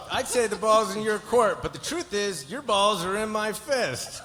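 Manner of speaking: high, punchy declaiming; over-the-top taunt. Acted. Lawyer taunting client over who has control, high and emphatic. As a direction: pitch it high and drag out the punchline.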